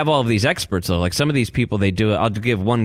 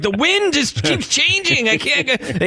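frustrated survivalist. Retold or imitated. Voicing the contestant who can't light a fire, high mock whine. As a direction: whine about the wind, hammed up.

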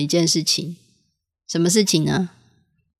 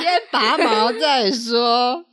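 slow, mock-serious instruction; drawn out; 6 semitones higher, much slower than usual. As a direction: stretch it out for a joke.

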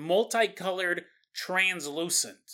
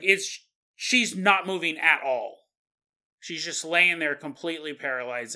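ordinary talk

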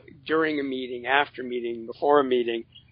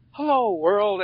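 cheerful wake-up greeting; 5 semitones higher, slower than usual. Retold or imitated. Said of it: "And it's like".

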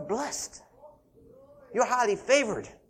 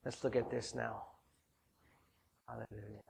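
hushed murmured praise, lower and softer; soft prayer; 11 semitones lower, 16 dB quieter than usual. Real, not acted. Preacher murmuring praise to God before reading, softly. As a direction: breathe the praise quietly to God.

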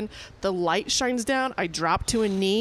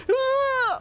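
imitated happy cry; 3 dB louder than usual. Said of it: "and she's like".

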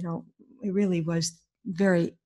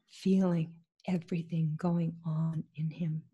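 ordinary talk, nothing marked

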